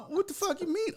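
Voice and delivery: high-pitched retold disbelief; comically exasperated question; incredulous. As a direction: squeak it out in outraged disbelief. Retold argument with clerk.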